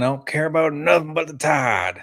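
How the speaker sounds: high, shouted imitation of a Bama fan; Bama fan impression. Quoting a viral clip to co-hosts, shouted fan impression. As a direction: holler it like an Alabama superfan. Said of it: "clip with that Bama fan".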